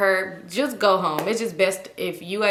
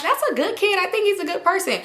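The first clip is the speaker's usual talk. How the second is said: high-pitched, sweet, trusting mom voice; naive mom; 9 semitones higher than usual. Retold or imitated. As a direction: imitate mom who is sure everyone is nice. Mom voiced praising a kid.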